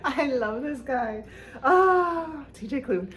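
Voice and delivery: emotional fan near tears; choked-up gushing. Real, not acted. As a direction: gush like you might cry.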